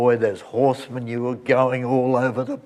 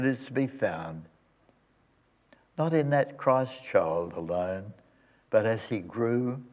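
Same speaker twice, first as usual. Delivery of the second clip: soft, hushed prayer voice, fading ever softer; closing prayer; 4 dB quieter than usual. Acted. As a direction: pray it gently and reverently.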